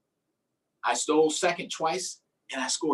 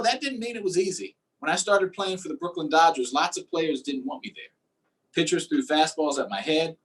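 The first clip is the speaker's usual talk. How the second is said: ordinary delivery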